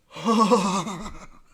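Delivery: a big loud relieved sigh out loud; loud sigh of relief after driving off. Acted. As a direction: let out a heavy audible sigh. Kyle sighs after driving away.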